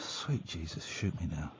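very quiet low groan; despairing mutter. Real, not acted. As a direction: mutter it under your breath, despairing. Host groans at next caller.

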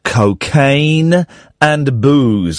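"Cocaine and booze" drawn out slowly, weight on "Cocaine"; a slow, pointed "Cocaine"; slow emphasis. Shown as a demonstration. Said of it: "Here's the thing".

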